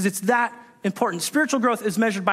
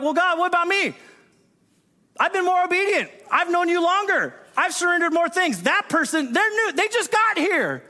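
high, whiny complaint climbing higher; jealous whine; 8 semitones higher than usual. Retold or imitated. Jealous believer complaining to God, high whining. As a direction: whine at God like a passed-over child.

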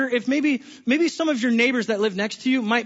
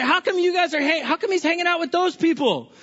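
higher, indignant mock complaint; self-righteous Pharisee; 7 semitones higher than usual. Pharisees questioning Jesus' company, indignant higher mock whine. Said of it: "the self-righteous Pharisees come and say".

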